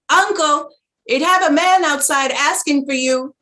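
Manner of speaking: high, loud call to someone outside; child shouting. Retold or imitated. Child calls the uncle to the door, loud high shout. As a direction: shout it across the yard to the uncle. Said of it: "He would shout to some child saying".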